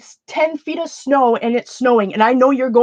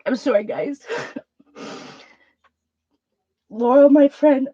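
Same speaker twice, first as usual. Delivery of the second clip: real tearful apology, halting between long pauses; crying; 3 semitones higher than usual. Real, not acted. Streamer apologising to viewers and a friend, crying. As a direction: struggle to get the words out through tears. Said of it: "I'm gonna cry".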